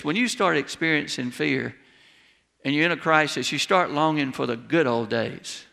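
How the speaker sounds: ordinary delivery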